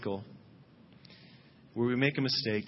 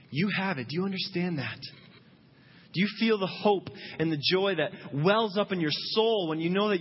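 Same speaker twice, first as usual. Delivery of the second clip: impassioned preaching; 7 semitones higher than usual. Acted. Preacher urging the doubting congregation toward joy. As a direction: lift the room, plead with them to feel it.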